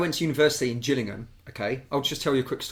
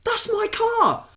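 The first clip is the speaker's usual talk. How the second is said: high, slow indignant cry; retold outraged shout about the car; shocked yell; 4 dB louder, slower than usual. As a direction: shriek it at the fighters. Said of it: "And I'm like".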